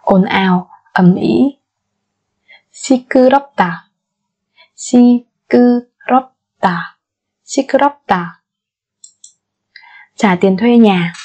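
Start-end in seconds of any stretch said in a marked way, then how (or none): 4.8-5.8 syllable by syllable. Shown as a demonstration. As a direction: break 시끄럽다 into four spaced syllables. Slow syllable-by-syllable model. (đọc 시끄럽다 chậm, tách từng âm tiết)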